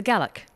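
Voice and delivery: "Gaelic" said as GAL-ik; correct pronunciation. Shown as a demonstration. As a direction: stress the first syllable of "Gaelic", short a. Introduces the Scottish pronunciation.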